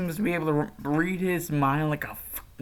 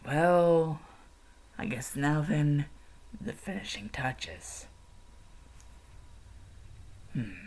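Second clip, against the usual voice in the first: sinister muttering; 8 dB quieter than usual. Acted. Villain muses over sleeping victim.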